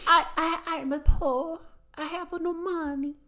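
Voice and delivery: high hammed Italian accent; Italian plumber accent; Mario voice. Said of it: "It's me, Mario".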